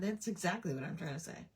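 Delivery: usual speaking voice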